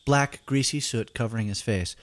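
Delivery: ordinary delivery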